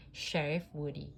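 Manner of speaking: usual speaking voice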